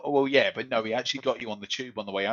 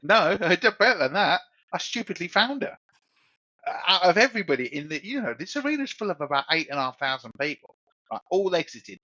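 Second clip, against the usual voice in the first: high, laughing rush of retelling; excited; 8 semitones higher, 3 dB louder than usual. Real, not acted. Recalling his stupid move to the podcast mate, high and excited. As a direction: tell it like you can't believe yourself.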